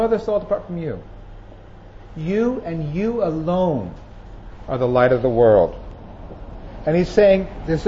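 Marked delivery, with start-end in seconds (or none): 2.2-5.7 "You and you alone" slow, each "you" leaned on; emphatic you. Shown as a demonstration. Preacher stressing the Greek emphasis to his listeners, slow and weighty. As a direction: drag out "you and you alone", the "you"s pressed hard. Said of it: "this is an emphatic term".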